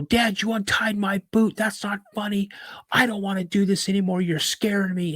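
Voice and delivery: put-on high, upset kid accusing dad; acted panicky complaint; retold flipping out. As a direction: protest at high pitch, rattled and accusing. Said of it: "I'm, like, flipping out on him".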